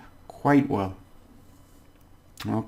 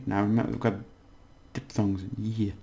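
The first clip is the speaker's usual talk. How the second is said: ordinary talk, nothing marked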